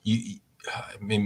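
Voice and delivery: soft unvoiced whisper; half-whispered slow aside; breathy whispered start. Real, not acted.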